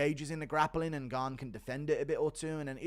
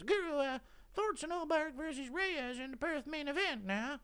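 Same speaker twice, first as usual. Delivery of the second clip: mock viewer voice. Rereading a viewer question in a voice.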